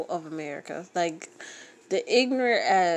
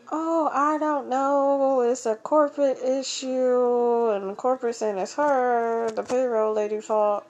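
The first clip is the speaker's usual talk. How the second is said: payroll lady's reply; 5 semitones higher, 4 dB louder than usual. Retold or imitated. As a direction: brush her off like an indifferent payroll clerk. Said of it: "She asked the pay lady".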